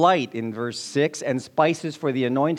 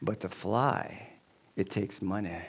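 lowered voice; 7 semitones lower, 10 dB quieter than usual. Acted. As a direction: drop low and soft for the sales pitch. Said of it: "lowers his voice and says".

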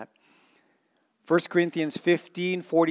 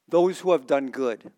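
normal talking voice

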